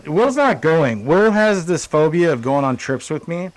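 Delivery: ordinary talk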